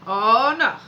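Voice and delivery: loud, playful oh no; mock alarm. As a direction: ham up the dismay, loud. Mom playfully answering toddler's oh yeah.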